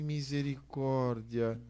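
slow, hushed cry for mercy; quiet, stunned aside, retold; retold dismay. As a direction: draw it out softly in mock dismay. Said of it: "Eu disse".

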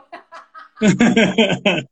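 bursting out laughing; hearty laugh, loud and open. Real, not acted. Laughs at a viewer's comment. (gargalhada alta)

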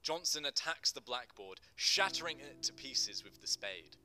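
ordinary delivery

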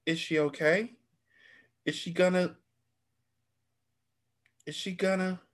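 fading into fear; frightened. Acted. Daughter fears for shot mother.

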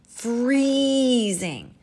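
FREEZING stretched long, high and loud; wailed, elongated FREEZING; exaggerated FREEZING. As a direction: draw out FREEZING in dramatic complaint. Voices the panda's complaint.

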